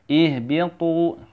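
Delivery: "Ihbitu" slowly with a lengthened final tu; deliberate chanted "Ihbitu"; long tu. Shown as a demonstration. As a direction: hold the tu of "Ihbitu" for two beats. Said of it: "Tu-nya dipanjangin dua harokat".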